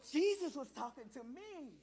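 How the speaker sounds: unvoiced gasp before a wondering line; breathless gasp, then line. Shown as a demonstration. Teacher leading adults to point at themselves, gasping. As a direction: gasp as the truth hits you. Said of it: "And I want you to say".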